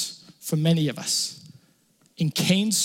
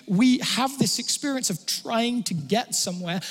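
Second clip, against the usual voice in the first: ordinary delivery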